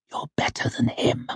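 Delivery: low urgent whisper in his ear; soft urgent whisper. A narrator's reading. Wife whispering flattery into her husband's ear, soft urgent whisper. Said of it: "whispered in his ear in that soft, low, urgent voice".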